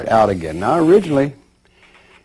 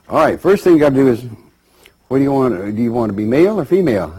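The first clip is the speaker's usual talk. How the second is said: fast sales pitch; 3 semitones higher, 5 dB louder, much faster than usual. Retold or imitated. Clerk hustling a new soul.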